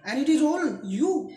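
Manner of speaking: slow, high, loud accusation; angry blame. Acted. Father blames his daughter for everything, angry accusation. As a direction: throw the blame slowly and forcefully.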